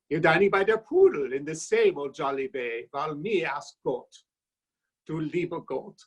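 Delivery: hammed-up German immigrant accent, declaiming. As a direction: recite the toast as a jolly German immigrant. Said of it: "here's my rendition of An Exile's Toast".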